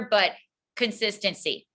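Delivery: ordinary talk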